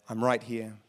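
very low, soft reassurance; gentle near-whisper. Acted. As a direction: hush it low, as God close by. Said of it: "what does he whisper?".

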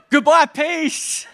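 slow, high, loud laughing farewell. Real, not acted. Father laughing at his chaotic morning, slow high and loud. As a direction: laugh it off in mock despair.

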